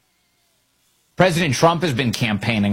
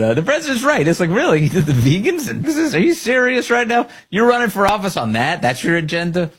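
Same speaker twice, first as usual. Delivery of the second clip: high scoffing disbelief, higher; mock the vegan agenda with disbelief; incredulous; 7 semitones higher than usual. Real, not acted. Host mocking a rival's platform, high and incredulous.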